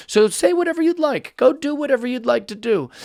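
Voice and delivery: high-pitched sarcastic go-ahead; mock permissiveness. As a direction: grant permission with exaggerated indulgence. Mock permission to the privileged player.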